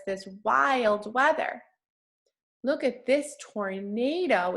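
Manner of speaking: normal talking voice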